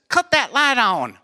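high, twangy Southern mother-in-law; hammed-up Southern accent; thick Southern accent. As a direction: mimic a Kentucky belle giving an order. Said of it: "also, when she says".

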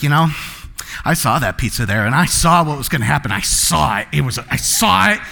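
low, ominous told-you-so voice; mock-grave seer dropping the pitch; stern prophet. Playing the prophecy type after the pizza spill, low and grave. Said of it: "you probably got the gift of prophecy".

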